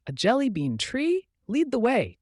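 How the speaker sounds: high, eager question and command; enthusiastic. A narrator's reading. Boy hears of jelly bean tree, enthusiastic.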